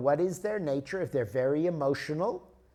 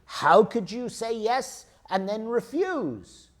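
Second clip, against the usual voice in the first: indignant reproach, higher and louder; slow, loud, accusing demand; angry; 6 semitones higher, 5 dB louder, slower than usual. Acted. Speaker confronts someone who refused to help, angry. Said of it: "I'm again angry".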